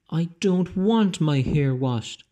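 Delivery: put-on wailing boy. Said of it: "wailed the boy in the bubbles".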